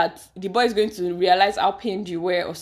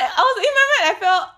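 much higher and louder, shocked; shocked disbelief; 14 semitones higher, 9 dB louder than usual. Real, not acted. Host reacts to the ex-girlfriend story with shocked disbelief. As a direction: gasp out your disbelief.

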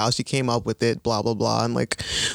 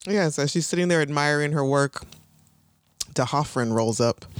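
ordinary delivery

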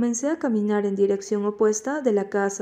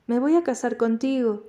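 ordinary delivery

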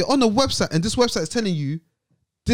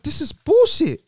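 retold outburst; 11 semitones higher, 4 dB louder, slower than usual. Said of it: "I was like".

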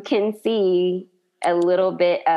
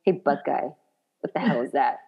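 unimpressed deadpan; 5 semitones lower, 4 dB quieter than usual. Retold or imitated. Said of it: "he's not impressed".

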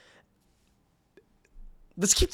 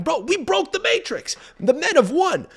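much higher, slow gloating; mock triumphant bro; 14 semitones higher than usual. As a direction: crow over the win like a bro. Imitating triumphant bros.

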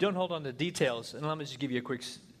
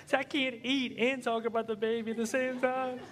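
higher whiny complaint; husband's inner whine; 10 semitones higher than usual. Retold or imitated. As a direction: grumble about being pulled from dinner.

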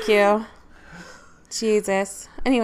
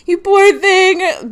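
put-on pitying baby talk; 8 semitones higher, 9 dB louder, much slower than usual.

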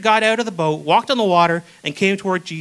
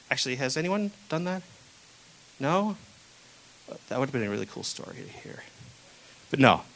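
quiet aside; 4 semitones lower, 7 dB quieter than usual. Real, not acted. Aside to congregation.

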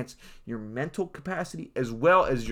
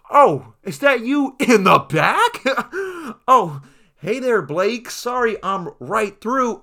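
slow, loud and high, teasing across the crowd; mock friendly call-out; 7 semitones higher, 8 dB louder, slower than usual. At the airport, teasing friend Blake from up front, slow loud smug call. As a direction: drawl a smug hello to a buddy far back. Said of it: "I'm looking like".

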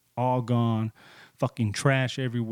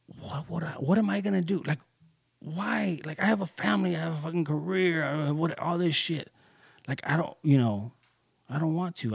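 high, frantic plea with the voice pitched up; friend's panicky whine, pitched high; panicked pleading; 6 semitones higher than usual. Retold or imitated. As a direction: imitate a desperate friend losing everything. Friend panicking over his losses.

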